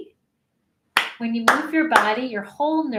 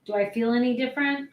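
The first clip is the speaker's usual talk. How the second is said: ordinary talk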